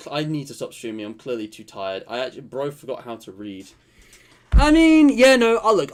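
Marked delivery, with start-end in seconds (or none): 4.5-5.8 flustered. Real, not acted. Flustered outburst.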